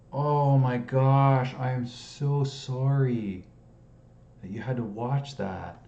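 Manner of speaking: loud, high, drawn-out apology; mortified apology. Real, not acted. Solver apologising to viewers for a blunder, mortified. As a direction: stretch it out, aghast at yourself.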